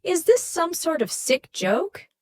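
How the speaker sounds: high and loud, indignant shout into the phone; furious yell. A narrator's reading. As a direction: yell it at a caller she thinks is pranking her. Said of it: "Amanda furiously yells into the phone".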